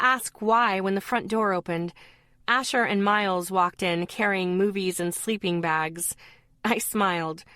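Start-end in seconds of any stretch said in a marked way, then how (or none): none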